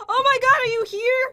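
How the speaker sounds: high, loud alarmed question; panicked exclamation. Real, not acted. Player caught crafting by opponent, panicked exclamation. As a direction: blurt it out on being caught.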